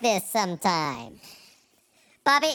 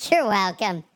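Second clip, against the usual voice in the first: ordinary talk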